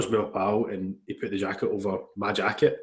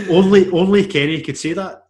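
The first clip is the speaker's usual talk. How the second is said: louder and higher, amused outburst; retelling a delighted reaction to a friend, higher and louder; amused exclamation; 8 semitones higher, 11 dB louder than usual. Retold or imitated. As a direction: blurt it out fondly amused. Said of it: "I was like".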